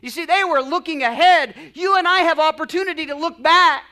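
high, loud, insistent repetition; full-force preaching. Real, not acted. Preacher urging congregation to look back, full-force preaching. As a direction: preach it in driving, building phrases.

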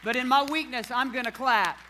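shouted, high and loud praise over the crowd; full-force preaching. Real, not acted. Pastor to the congregation, shouting praise at full force. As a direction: preach it out at the top of your lungs.

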